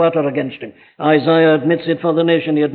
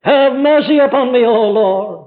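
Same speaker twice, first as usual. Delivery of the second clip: high, louder cry for mercy; pleading for mercy; 6 semitones higher, 4 dB louder than usual. Retold or imitated. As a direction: cry out lightly like a penitent. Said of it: "David doesn't do that".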